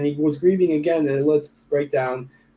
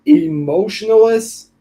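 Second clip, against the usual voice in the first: incredulous echo; 6 semitones higher, 5 dB louder, much slower than usual. Real, not acted. Reading a friend's worrying message.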